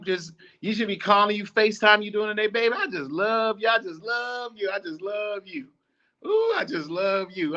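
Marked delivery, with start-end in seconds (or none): 2.5-4.7 raised, mock-swooning girlfriend on FaceTime; lovesick girlfriend. Mocking a lovesick woman on FaceTime. 4.7-7.5 fake-sobbing it out of sheer devotion; mock weepy love. Mocking a lovesick woman on FaceTime.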